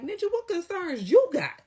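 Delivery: high, loud mock challenge from the office guy; leasing office man. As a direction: fire back the question like a brash office worker. Leasing office man confronting him.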